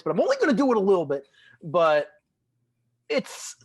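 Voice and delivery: high, loud comic character voice; Nick Kroll. Acted. Podcast host briefly trying a comedian's voice before reading, hammed-up impression. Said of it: "like, Nick Kroll voice".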